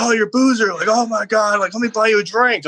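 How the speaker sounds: high, gushing starstruck fan voice; excited fan. Retold or imitated. Fans at a bar gushing over meeting him, high and excited. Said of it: "they'd be like".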